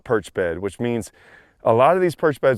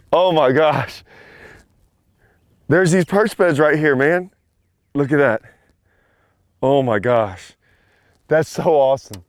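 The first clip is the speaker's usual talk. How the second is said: lingering excitement; 3 semitones higher, 4 dB louder than usual. Real, not acted. Admiring the fish, still excited.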